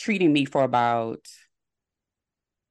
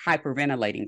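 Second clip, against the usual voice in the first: ordinary talk, nothing marked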